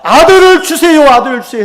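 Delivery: loud, high, crying out for a son; desperate pleading prayer. Retold or imitated. Preacher voicing Hannah begging God for a son, loud pleading. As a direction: beg God at the top of your voice.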